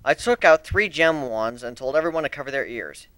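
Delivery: normal talking voice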